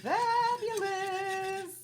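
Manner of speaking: high, slow, drawn-out gush; delighted. Real, not acted. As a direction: gush over the potatoes. Shows off the mashed potatoes.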